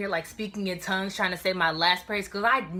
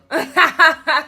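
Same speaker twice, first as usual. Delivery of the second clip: loud high laugh; cracking up at the memory; 9 semitones higher, 12 dB louder than usual. Real, not acted.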